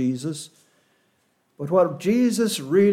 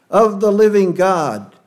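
louder, stressing living God; emphatic quote, higher and louder; 4 semitones higher, 6 dB louder than usual. Shown as a demonstration. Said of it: "Every word here is emphatic".

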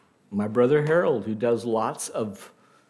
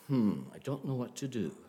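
much softer, musing mock puzzlement; mock puzzled; 3 semitones lower, 12 dB quieter than usual. Preacher imagining God stumped over whom to pick, softly mock puzzled. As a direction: think aloud quietly, pretending to be stumped. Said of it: "scratching his head and saying".

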